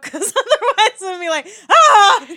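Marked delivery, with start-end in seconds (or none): 1.7-2.3 loud, high yelp of pain; hammed-up shriek; mock pained scream. Actor clawed by a thrown cat, loud mock scream. Imagines cat landing claws out.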